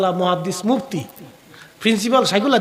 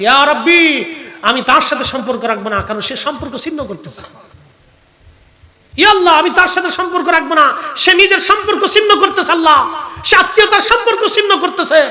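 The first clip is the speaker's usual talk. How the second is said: pleading cry; 6 semitones higher, 5 dB louder than usual. Acted. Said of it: "আরশে আজিম বলতেছে".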